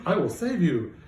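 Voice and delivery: a mild hammed-up hero shout; heroic shout.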